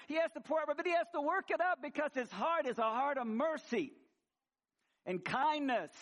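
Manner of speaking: fervent preaching. Real, not acted. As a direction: preach it with fire. Preaching at full force.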